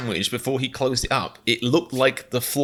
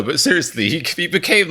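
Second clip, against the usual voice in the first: ordinary talk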